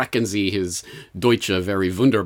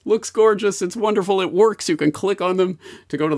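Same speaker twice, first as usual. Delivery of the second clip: mock gushing; 8 semitones higher than usual. Sarcastic praise of website.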